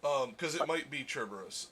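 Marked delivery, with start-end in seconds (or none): 1.1-1.7 Cerberus opened with a "ch" sound; Italian pronunciation. Shown as a demonstration. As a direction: say Cerberus as "CHER-ber-us". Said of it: "in, in Italian".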